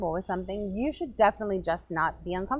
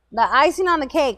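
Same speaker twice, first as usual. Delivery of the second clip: ordinary delivery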